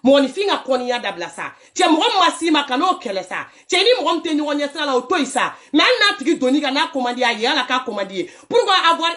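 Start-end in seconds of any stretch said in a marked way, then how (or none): none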